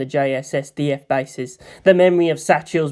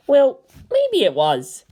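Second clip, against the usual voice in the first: high sheepish friend; 9 semitones higher, slower than usual. Acted. Kensuke admits fear.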